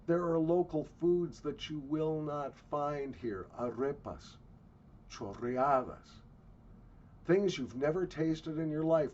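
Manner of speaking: usual speaking voice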